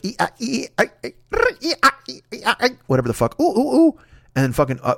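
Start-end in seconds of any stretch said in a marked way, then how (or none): 0.0-2.7 high-pitched monkey screeches; squeaky ee-ah monkey noises; monkey chatter. Acted. Host imitating Gleek the monkey, high chattering. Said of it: "And then Gleek is like". 3.4-4.0 high ooh-ooh monkey calls; short, high ape hoots. Acted. Host imitating Gleek the monkey, high hooting. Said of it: "And then Gleek is like".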